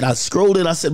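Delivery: ordinary talk, nothing marked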